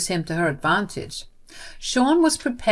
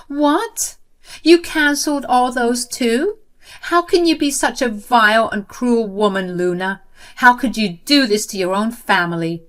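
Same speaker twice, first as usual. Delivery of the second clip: high, louder indignant cry; outraged shock; 5 semitones higher, 6 dB louder than usual. Acted. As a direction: accuse her in disbelief. Jenny outraged on the phone.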